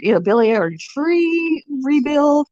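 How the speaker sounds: normal talking voice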